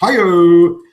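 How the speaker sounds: loud high laughing hi-yo; laughing whoop. Real, not acted. Punchline whoop after joke.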